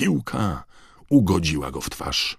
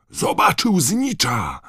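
high, slow-stretched yell; excited yell; 13 semitones higher, 4 dB louder, slower than usual. A narrator's reading. Harry spots the seeker going for the snitch, yelling. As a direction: yell it out high over the crowd. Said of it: "wrzasnął Harry".

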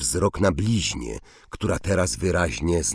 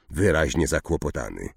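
ordinary delivery, nothing marked

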